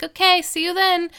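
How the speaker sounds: high, airy imitation of a cheery sign-off; mock-chirpy farewell; breezy goodbye. Retells a girl's breezy goodbye.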